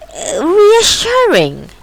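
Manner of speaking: high, slow sarcastic sneer. Acted. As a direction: spit it out with sarcasm. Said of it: "he spat".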